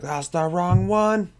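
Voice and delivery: high, drawn-out imitated whine; mock complaint. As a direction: mimic a complaining viewer, slow and high. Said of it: "later you're like".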